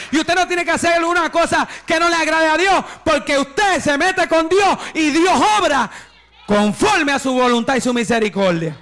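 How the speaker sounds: rising preacher's cry that God makes the impossible easy; fervent preaching. Real, not acted. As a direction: preach it at full force, climbing to a shout. Preacher exhorts the congregation.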